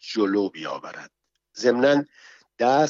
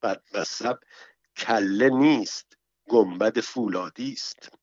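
ordinary delivery, nothing marked

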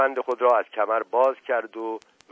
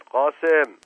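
loud, higher shouted name; commanding shout; 6 semitones higher, 7 dB louder, much slower than usual. A narrator's reading. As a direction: shout to stop a servant. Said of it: "فریاد زد". (فریاد آمرانه)

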